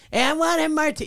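high, pushy demand; whiny stripper demand. Retold or imitated. Stripper demanding a martini from sound guy, high whiny voice. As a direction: imitate the stripper insisting on her drink.

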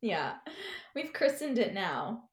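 ordinary talk

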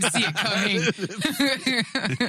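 slow laughing delivery. Real, not acted. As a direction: say it through laughter. Laughing at Pickle Rick.

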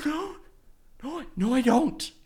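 high, small mock-panicked squeak; retold caught-out panic; panicked denial.

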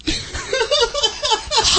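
high burst of laughter. Real, not acted. Laughing at a punchline.

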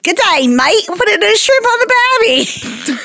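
high, hammed-up Aussie accent; jokey Australian greeting; mock Australian accent. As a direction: rise into a cartoon Australian twang. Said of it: "I use a different accent every day".

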